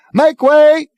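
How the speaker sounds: high, loud bawling; shout through megaphone. Acted. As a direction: clear the crowd with a yell. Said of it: "he shouted".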